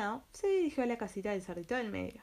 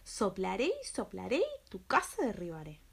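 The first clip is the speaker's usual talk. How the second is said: angry wolf. Acted. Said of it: "se enfureció y dijo".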